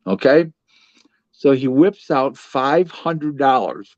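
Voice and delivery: ordinary talk, nothing marked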